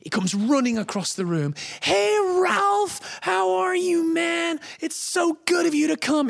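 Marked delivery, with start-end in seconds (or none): 1.8-6.3 very high, hearty Tom Hanks hello; high-pitched, over-friendly impression; effusive greeting. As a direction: gush a big welcome across the room. Tom Hanks greets nervous auditioner.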